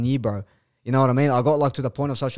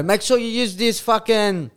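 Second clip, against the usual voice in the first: mock ad read; 6 semitones higher than usual. Mocking a promo ad read.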